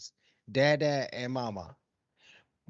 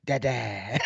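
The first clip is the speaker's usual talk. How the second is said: put-on toddler dada; 6 semitones lower than usual. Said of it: "he looks me dead in my eyes and he says".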